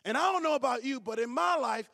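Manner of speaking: ordinary talk, nothing marked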